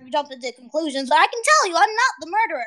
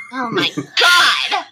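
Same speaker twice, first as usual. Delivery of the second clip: laughing shriek; much slower than usual. Real, not acted. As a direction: laugh in disbelief. Reacting to wall-beating joke.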